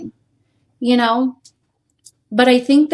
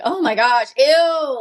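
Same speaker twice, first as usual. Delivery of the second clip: mock disgust; 5 semitones higher, 3 dB louder, slower than usual. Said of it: "I was like".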